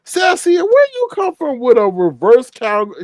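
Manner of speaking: high, stretched outraged question; appalled high exclamation; shocked disbelief. Retold or imitated. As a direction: drag it out in disbelief. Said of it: "I was like".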